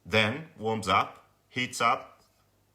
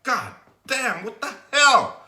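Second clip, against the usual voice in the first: higher, louder swearing at the bike; exasperated yell of disbelief; frustrated outburst; 10 semitones higher, 8 dB louder than usual. Retold or imitated. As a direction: blurt it out, fed up. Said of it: "I'm like".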